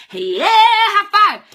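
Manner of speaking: excited high five. Retold or imitated. Telling Katie she feels the same, an excited cheer. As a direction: celebrate with her, big and drawn out.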